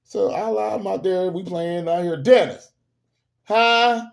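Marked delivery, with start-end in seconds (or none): 2.2-2.7 put-on loud call of a child's name; mock stern holler; stern mother calling. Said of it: "I could tell in the tone of her voice". 3.4-4.1 high, loud answer; mock-innocent high holler; startled child answering. Child answers mother's call, acted.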